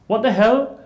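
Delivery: very high, loud mock exclamation; retold indignant outburst. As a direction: burst out as the indignant professor.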